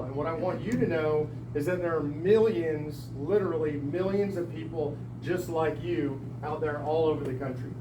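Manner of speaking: ordinary talk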